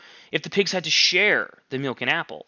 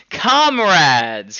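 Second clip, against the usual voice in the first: a called-out greeting, louder; cried out; 3 semitones higher, 7 dB louder than usual. Acted. Squealer calling out to the animals, louder. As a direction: hail the crowd of animals. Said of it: "he cried".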